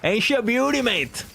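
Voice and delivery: high, hammed-up Australian twang; a badly faked Australian accent, played for laughs; mock Australian accent. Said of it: "what does an Australian sound like".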